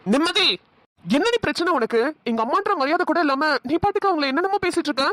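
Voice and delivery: high-pitched, heated scolding; indignant confrontation. Acted. Man demanding why she disrespects his mother, indignant confrontation. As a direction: confront her, pitch jumping well up.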